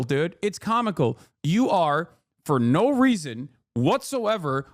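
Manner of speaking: ordinary talk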